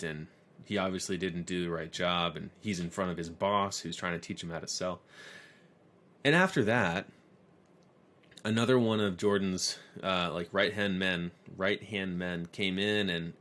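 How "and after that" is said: usual speaking voice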